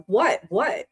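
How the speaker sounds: high, loud startled "what?"; re-enacted alarm, pitch jumping up; startled questioning. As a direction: blurt it as if jolted awake. Retelling being woken by mother.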